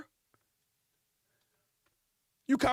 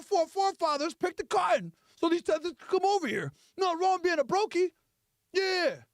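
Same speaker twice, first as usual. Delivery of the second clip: streamer's rousing speech, pitched up; mock activist streamer, emphatic; Tariq Nasheed; 5 semitones higher than usual. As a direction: preach to the followers in a raised voice. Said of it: "You turn on the Tariq Nasheed stream".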